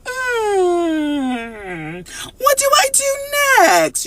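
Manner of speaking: high, far, whiny what do I do; mock lost wail. Playing someone stuck in fog, high whiny wail. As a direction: act out the lost, anxious wondering. Acting out confused wondering.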